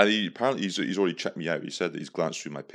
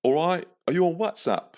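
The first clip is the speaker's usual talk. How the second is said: high, loud mock-London trader; attempted London accent; 11 semitones higher, 7 dB louder than usual. London trader asks for WhatsApp, in a put-on London accent. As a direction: put on a badly faked London accent, pitched up. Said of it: "And then he replies".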